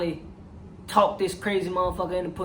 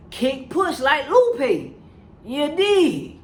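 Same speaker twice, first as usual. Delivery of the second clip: cocky sign-off; 6 semitones higher, 3 dB louder, much slower than usual. Retold or imitated. [name] tossing off a [name] line.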